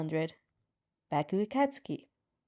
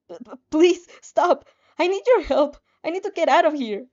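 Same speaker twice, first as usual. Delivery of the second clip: much higher, loud stammered plea; high, loud, frantic cry for help; desperate shouting; 6 semitones higher, 9 dB louder than usual. Acted. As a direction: yell after him, frantic. Chasing him, begging for help.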